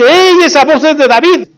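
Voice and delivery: high-pitched startled call, acted out; startled exclamation. Saúl, woken by the shouting, recognizes David's voice, startled.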